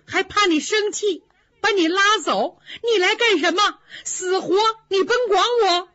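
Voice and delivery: sulky fury with a crack of tears; tearful outburst. Acted. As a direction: Daiyu lashing out at Baoyu, high and tearful. Daiyu's jealous outburst at Baoyu.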